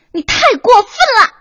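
high, loud tearful protest that rushes then slows; crying outburst. Acted. Host cries at partner who snapped at her, loud and high. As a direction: wail the hurt out between sobs. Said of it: "你哭什么呀".

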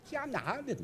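ordinary talk, nothing marked

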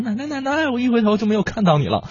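put-on high little-girl voice calling out. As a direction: call out like a small child who lost her grandma. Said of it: "喊".

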